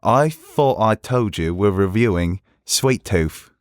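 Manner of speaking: slow put-on East London accent; deliberate mock East London delivery; East Londoner accent. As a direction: lay on the East London vowels, taking your time. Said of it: "I could do East Londoner".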